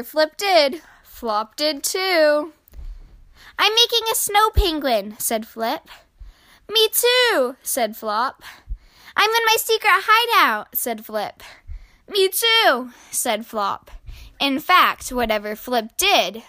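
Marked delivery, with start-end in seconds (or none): 3.6-5.2 put-on high, excited child's voice; high-pitched childlike excitement; little penguin at play. Penguin child announcing his game. 6.7-7.6 put-on high childlike chirp; high-pitched little brother voice; eager little copycat. 9.1-10.7 put-on high, excited child's voice; high-pitched childlike excitement; little penguin at play. Penguin child announcing his game. 12.1-12.9 put-on high childlike chirp; high-pitched little brother voice; eager little copycat.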